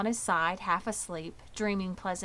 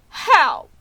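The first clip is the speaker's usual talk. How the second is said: high, much louder quick question; eager question; 8 semitones higher, 15 dB louder than usual. Acted. Wilbur presses Charlotte for her plan, high and much louder. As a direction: ask it eagerly. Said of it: "asked Wilbur".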